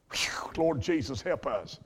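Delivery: a slow breath of a prayer; hushed "Whew, Lord Jesus"; whispered prayer. Real, not acted. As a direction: whisper it, overcome. Moved aside to God.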